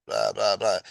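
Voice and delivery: plain reading voice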